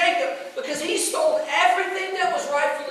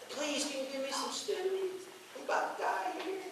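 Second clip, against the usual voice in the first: faint, much softer begging, the last words quicker; weak pleading; 5 semitones higher, 15 dB quieter than usual. Retold or imitated. Starving brother begging his twin for stew, faint and pleading. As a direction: beg for food as if too starved to speak up.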